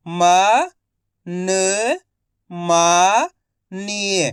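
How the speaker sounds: each syllable said alone, loud and clipped, then the whole word drawn out slowly; slow Hindi reading drill, syllable by syllable; phonics sounding out. Shown as a demonstration. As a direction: spell it out syllable by syllable for a child, then blend it slowly. Teacher sounds out and blends words.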